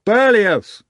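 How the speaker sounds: high, loud sudden cry; name cried out. A narrator's reading. Someone at a restaurant table, crying out the name. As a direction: call the name out in alarm. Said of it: "a name came up at one of the tables".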